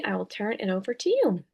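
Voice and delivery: normal talking voice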